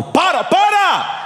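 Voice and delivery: high drawn-out cry of stop; hammed-up high yell; shrill shout. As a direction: shriek it out slowly, mock alarm. Shrieking at the crowd.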